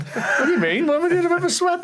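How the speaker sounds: high, baffled retort with a laugh; mock indignation. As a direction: throw the question back, pitch climbing. Acted indignant reply to the tutor.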